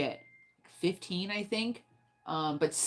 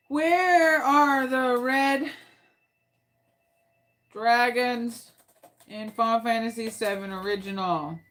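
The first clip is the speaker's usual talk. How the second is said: drawn-out query; 5 semitones higher, slower than usual. Real, not acted. Exasperated search query.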